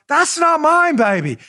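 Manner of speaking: high, swinging spy catchphrase; mock the reporter with the groovy denial; Austin Powers. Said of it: "Fusion Ken doing the Austin Powers".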